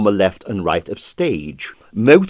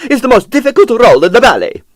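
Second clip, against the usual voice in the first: much higher, louder, fast fury; livid, quivering; 12 semitones higher, 7 dB louder, faster than usual. Acted. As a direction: rattle it off in outrage. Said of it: "livid and quivering".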